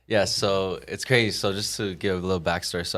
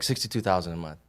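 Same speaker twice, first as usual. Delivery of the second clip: lower, softer offhand figure; nonchalant; 4 semitones lower, 6 dB quieter than usual. Retold or imitated. Agent quoting the recruiter's huge earnings, nonchalant. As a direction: imitate him tossing it off casually. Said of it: "So nonchalant about him".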